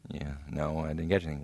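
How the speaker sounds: glum reply. Acted.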